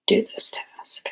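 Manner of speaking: slow hushed whisper. Real, not acted. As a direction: whisper it under your breath. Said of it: "'cause I have to whisper".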